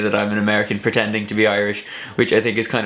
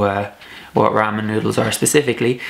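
usual speaking voice

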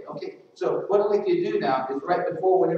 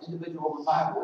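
ordinary talk

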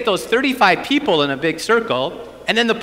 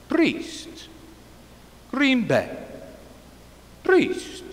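lightly voiced elderly Pope, halting, higher; slow, halting repetition, pitched up; imitating the Pope; 8 semitones higher, 5 dB quieter, much slower than usual. Priest imitating the Pope repeating his words back, halting. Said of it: "he looked at me and he said".

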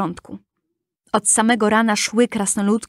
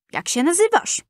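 kind dwarfs asking; 8 semitones higher than usual. A narrator's reading.

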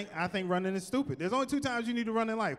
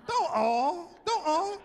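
higher, laughing "Don't all"; laughing protest; 8 semitones higher, much slower than usual. Real, not acted. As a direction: laugh while cutting them off. Laughing at rival branches.

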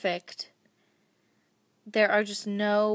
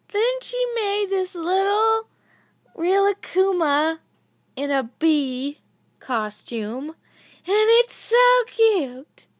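higher and louder, gushing over the charm; excited squeal; 10 semitones higher, 7 dB louder than usual. Real, not acted. Showing viewers the bee charm, a high excited squeal. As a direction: squeal it out, slowing right down on the last words. Unboxing a beloved handmade charm.

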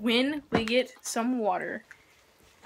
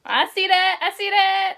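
loud teasing; 8 semitones higher, 8 dB louder than usual. Real, not acted. Teasing the other's face.

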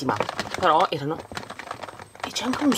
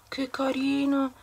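cute cooing voice; 11 semitones higher, slower than usual. Real, not acted. Cooing over a pet toy.

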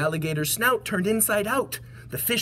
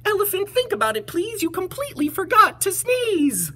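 very high, squeaky mouse voice; little mouse; 14 semitones higher, 3 dB louder than usual. Acted. Mouse reassures the scared elephant, high squeaky voice. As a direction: chirp it in a tiny high voice. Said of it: "The mouse laughed".

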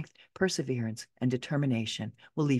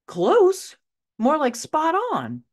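high, loud incredulous echo; pitched way up, emphatic disbelief; astonished retort; 12 semitones higher, 5 dB louder, slower than usual. Retold or imitated. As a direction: throw it back in amazement. Amazed retort to the readers' question.

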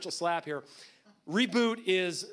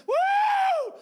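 very high, loud whoop; long piercing holler of celebration; imitated celebratory shout; 11 dB louder than usual. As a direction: whoop like an overjoyed groom. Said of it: "celebrate and shout".

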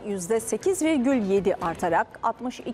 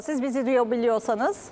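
normal talking voice

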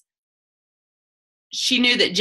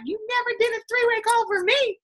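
high put-on whiny accuser voice; needling team member teasing her; mocking team member; 13 semitones higher than usual. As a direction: mimic a complaining downline member, pitched way up.

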